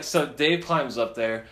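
usual speaking voice

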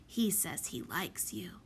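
small, hushed confiding voice. A narrator's reading. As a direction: share it quietly, like a secret. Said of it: "confided the prodigy".

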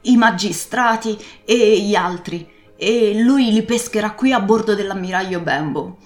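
loud, pressing sea captain giving orders; urgent old pirate. Acted. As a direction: send the boy off urgently. Captain urges Jim to ride.

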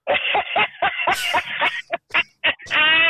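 long burst of high laughter. Real, not acted. Host laughing at her co-host's [name] joke, long laugh. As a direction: crack up at the joke.

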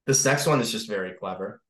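usual speaking voice

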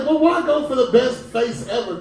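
usual speaking voice

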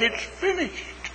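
high, soft and slow; a faint, slow whisper. A narrator's reading. The trees whispering to the birds, slow and soft. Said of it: "the trees whisper to the birds".

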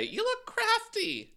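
very high, slow mock compliment; drawn-out, high-pitched gushing judge; Ross impression. Ross critiquing a queen's look, high drawn-out mock gushing. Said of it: "Ross is gonna say".

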